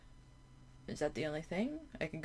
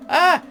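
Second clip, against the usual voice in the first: sudden loud high yelp of fright; jump-scare cry; startled yelp; 10 semitones higher, 12 dB louder than usual. Real, not acted. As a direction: yelp as the tentacle bursts out. Tentacle bursts out.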